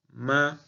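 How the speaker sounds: "ma" high, level and held; first tone. Shown as a demonstration. Teacher showing first tone with hand gesture, "ma" high and level. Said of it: "al decir el primer tono".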